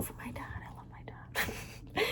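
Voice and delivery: breathy hushed whisper. Pretend. Vlogger whispering gratitude for her dad to viewers. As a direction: whisper it like a secret confession.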